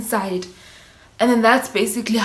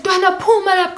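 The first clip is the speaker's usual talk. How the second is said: friends calling; 9 semitones higher, 4 dB louder, faster than usual. Retold or imitated. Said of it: "They're like".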